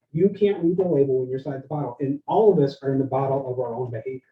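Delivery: ordinary talk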